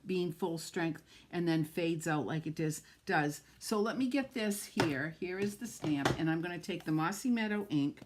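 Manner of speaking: ordinary talk, nothing marked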